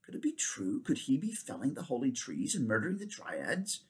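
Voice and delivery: king asks in dread, barely aloud; very low voice. Acted. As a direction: say it low, afraid to believe it. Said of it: "in a very low voice".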